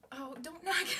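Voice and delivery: laughing, loud and high; laughing warning. Real, not acted. Laughing as she warns her cat off the makeup. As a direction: warn through a laugh.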